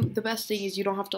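normal talking voice

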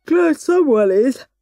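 stretched-out, slow words with an intake of breath; yawning. A narrator's reading. Henry grumbles to his brother at breakfast, yawning. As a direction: talk through a big yawn.

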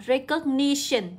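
"recognition" slowly, stressed on NI; nhấn âm ba. Shown as a demonstration. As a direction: raise the third syllable of "recognition". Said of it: "sẽ nhấn vào âm ba". (đọc chậm "recognition", nhấn vào "ni")

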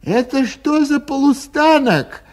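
high, loud puzzled cry; a confused traveller shouting from the train. A narrator's reading. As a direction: call out what station this is. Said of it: "закричал он".

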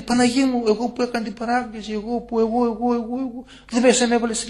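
imitated high-pitched pleading cries; retold wailing plea. Damned girl crying out to the Virgin Mary from hell, imitated wailing. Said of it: "Φωνές αυτή".

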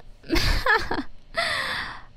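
high giggling cackle; mischievous snitch laughing; sly high laugh. Acted. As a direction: cackle like you got away with it. Friend reveals she snitched.

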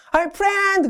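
high, hammed-up call to a pal; mock-friendly high call; hearty friend greeting. 미국의 친구 인사 흉내.

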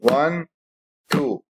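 soft slow count-in; quiet slow one two. Shown as a demonstration. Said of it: "هقول إيه".

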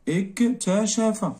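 slow dictation. Shown as a demonstration. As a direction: stretch اكتشف out for someone writing it. Dictating word while writing it.

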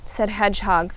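ordinary delivery

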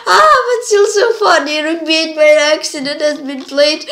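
high, loud and quick amusement; amused. Real, not acted. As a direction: giggle through it. Amused by the accident.

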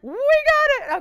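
high, loud triumphant cheer; excited cheer. Real, not acted. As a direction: celebrate the winning bid out loud. Bid finally lands in auction.

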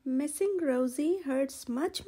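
plain reading voice

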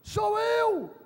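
high, loud, commanding reassurance; Jesus calming. Acted. Jesus calling to disciples in storm, loud reassurance. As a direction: call out over the storm. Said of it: "Jesus falou assim".

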